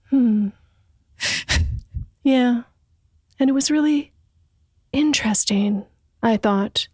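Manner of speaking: airy, quirky storybook reading; breathy mock narrator voice. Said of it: "this sort of quirky, like, breathy sort of".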